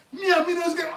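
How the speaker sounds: high, loud Jamaican taunt through a laugh; mock Jamaican shout. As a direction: yell it in Jamaican accent, half laughing. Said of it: "he's like".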